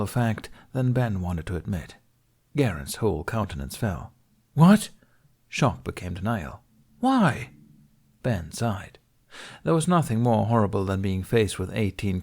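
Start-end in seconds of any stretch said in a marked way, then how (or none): 4.5-4.9 sharp, high and loud disbelief; a student stunned by the news; shocked. A narrator's reading. Shocked padawan reaction. 7.0-7.5 very high pleading question; disbelieving cry. A narrator's reading. Denial after shock.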